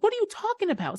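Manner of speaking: baffled disbelief. Retold or imitated. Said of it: "I'm sitting there like".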